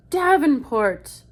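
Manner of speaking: high, loud drawn-out call. Retold or imitated. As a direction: imitate a pharmacy worker calling out, loudly and slowly. Pharmacy guy calls out a name.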